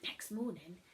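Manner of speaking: plain reading voice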